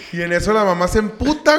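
usual speaking voice

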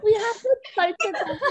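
put-on high anime girl voice breaking into laughter; giggly put-on anime girl. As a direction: pitch it up, barely holding back a laugh. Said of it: "I'm gonna do that for Raven's voice".

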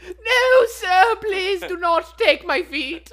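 high, loud, slow wailing plea; mock terrified peasant. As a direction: wail for mercy, hammed up. Continues scared peasant bit.

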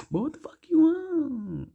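jumps way up high and slow, back to her own voice; suddenly high girl voice. Retold or imitated. Mimicking her on the phone, suddenly high and slow. Girl drops the boy act.

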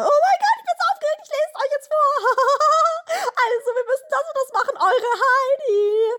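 put-on high squealing excited girl; giddy party girl. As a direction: squeal it like an overexcited teen. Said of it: "Und dann kreischt Dasha erstmal".

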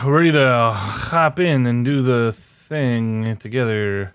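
slow, sighing delivery. Real, not acted. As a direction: let a weary sigh carry it. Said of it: "I like that built-in sigh in your voice".

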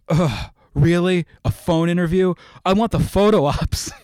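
loud, high whiny complaint; petulant child. Retold or imitated. Upset fan whining about a phone interview, loud and whiny. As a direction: whine it like a spoiled kid who lost his photo op. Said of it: "I'm like a petulant child".

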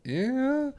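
high, drawn-out, uncertain answer; hesitant squeak. Retold or imitated. Teen answering a cop about his dad, mock hesitant squeak. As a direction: squeeze it out high and small.

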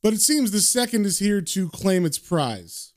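ordinary delivery

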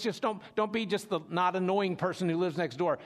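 ordinary talk

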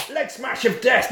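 usual speaking voice

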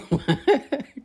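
high-pitched burst of laughter. Real, not acted. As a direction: crack up at own bit. Laughing at own joke.

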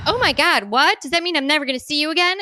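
high, fast gush of alarm; panicked little girl. Retold or imitated. Daughter gasping to her friend, high and fast. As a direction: squeal it like a worried kid. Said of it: "she was like".

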